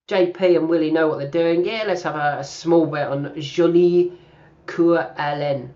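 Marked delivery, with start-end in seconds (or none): 3.4-5.7 Jolie Coeur Hélène slowed in a hammed-up French accent; slow, mock-French flourish on Jolie Coeur Hélène. Shown as a demonstration. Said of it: "you have to say it like that with that French accent".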